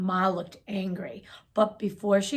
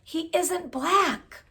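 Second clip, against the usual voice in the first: put-on higher and slow, a child's confiding voice; slow, higher-pitched child whisper-voice; hushed aside; 7 semitones higher, slower than usual. A narrator's reading. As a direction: lean in and say it slowly, pitched up. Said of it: "whispered Natalie".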